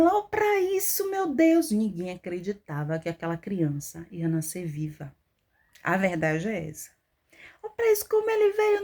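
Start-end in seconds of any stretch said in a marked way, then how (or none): 0.0-1.7 high, drawn-out reenacted wonder; retold astonishment, pitched way up; amazed exclamation. Retelling amazement at newborn crying. 7.6-8.8 very high, reenacted marvelling; retold wonder, pitched way up; amazed exclamation. Retelling amazement at the baby's birth.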